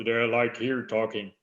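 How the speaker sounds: low voice pushed back in the throat. Shown as a demonstration. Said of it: "go back in their throat".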